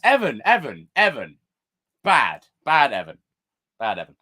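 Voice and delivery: a hint of scolding a naughty dog; scolding a pet. Streamer scolds Evan like a naughty dog, lightly voiced. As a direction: tut at him like a bad puppy.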